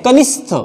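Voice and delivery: "कनिष्ठ" with s in place of sh; mispronunciation demo. Shown as a demonstration. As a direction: say "कनिष्ठ" as kanist-ha. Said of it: "ये गलत है".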